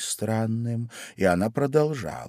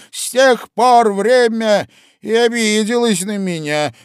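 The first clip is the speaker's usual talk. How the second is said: gloomy Hatter; 14 semitones higher, 12 dB louder than usual. A narrator's reading. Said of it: "мрачно проговорил Шляпник".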